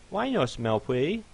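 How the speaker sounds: put-on high baby-talk plea; begging fluffy pony. Fluffy begs.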